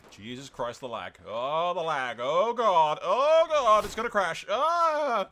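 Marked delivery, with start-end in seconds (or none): 1.3-5.3 rising, loud cry of dread; alarmed wail. Real, not acted. Gamer dreading a crash as lag hits, alarmed wailing. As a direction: wail higher and louder as disaster looms. Game lags, fears a crash.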